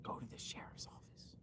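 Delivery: a hushed, secretive whisper. Pretend. Vlogger mockingly repeating the lady's line, in a whisper. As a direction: breathe it like a spooky secret. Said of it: "whispered repeat of the quote".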